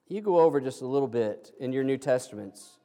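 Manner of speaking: ordinary delivery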